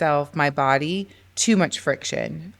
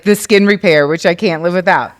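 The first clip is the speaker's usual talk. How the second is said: urgent exclaim; 2 semitones higher, 8 dB louder than usual. Retold or imitated. Panicked lip balm realisation.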